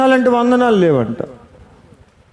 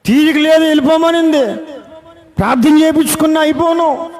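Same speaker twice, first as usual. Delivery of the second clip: higher, urgent, grieving pastor; distressed pastor mourning the girl he saw that morning; retold grief; 6 semitones higher than usual. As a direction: pour out his shock and sorrow, pitched up. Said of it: "ఆయన బాధపడిపోయి".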